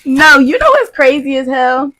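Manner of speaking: ordinary talk, nothing marked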